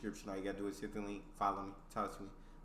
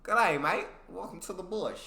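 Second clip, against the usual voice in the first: higher-pitched Australian greeting; Australian accent; 6 semitones higher than usual. Shown as a demonstration. Welcoming a mate to the bush, Australian accent. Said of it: "I'll try it".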